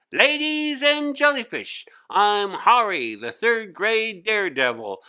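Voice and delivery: put-on boy on a chair declaring himself the class daredevil; showman daredevil. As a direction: announce yourself like a stunt showman. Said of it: "He stood on a chair and announced".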